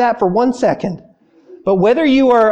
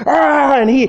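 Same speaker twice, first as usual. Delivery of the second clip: ordinary talk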